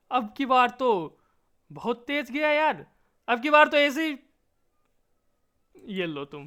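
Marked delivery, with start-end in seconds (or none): 0.1-4.2 loud, high burst of excitement; excited shout. Real, not acted. Gamer cheering his character's big jump, loud and high. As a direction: yell it out as the shot flies.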